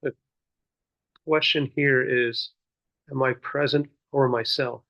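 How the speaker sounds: measured meditation guidance with long pauses; guided meditation. Real, not acted. Teacher leading seated listeners in muraqabah, guiding contemplatively. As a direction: guide them inward, one unhurried phrase at a time.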